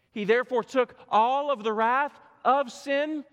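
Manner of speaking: high, loud, slow preaching on the cross; forceful preaching. Acted. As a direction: thunder it from the pulpit, slowly.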